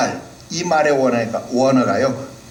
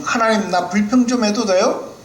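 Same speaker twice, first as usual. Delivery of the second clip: higher, pleading voice; like a child asking permission; cute Job asking; 8 semitones higher than usual. Acted. Job sweetly asking God to let him complain. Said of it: "지금".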